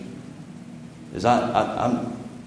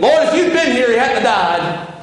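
much higher and louder, then slowing down; raised, reproachful grieving sister; accusing complaint; 12 semitones higher, 6 dB louder than usual. Acted. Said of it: "the different spirit between those two women".

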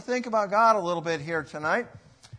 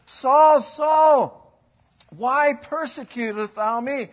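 higher, louder ringing call; 5 semitones higher, 7 dB louder, much slower than usual. Acted. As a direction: call his name out across the room. Preacher voices the Lord calling.

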